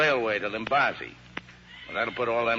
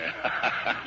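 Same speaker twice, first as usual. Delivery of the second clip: short breathy chuckle at his own joke. Acted. Riverman chuckling to the lady at his joke. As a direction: laugh it off lightly.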